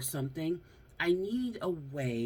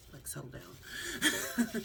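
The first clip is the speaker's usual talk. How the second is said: laughing; 8 semitones higher, much slower than usual. Real, not acted. Laughing at accent critics.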